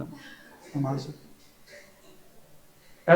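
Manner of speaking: slow, soft menacing whisper. Retold or imitated. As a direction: threaten quietly, dragging the words out. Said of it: "एकदम शक्ल change मेरी". (धीमी धमकी भरी फुसफुसाहट)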